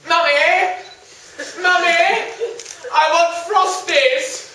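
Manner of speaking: put-on high, loud, whining like a spoilt kid; whiny child voice. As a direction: demand cereal like a bratty child. Young man whining for cereal.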